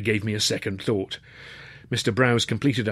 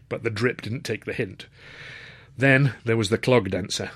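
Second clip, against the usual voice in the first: ordinary delivery, nothing marked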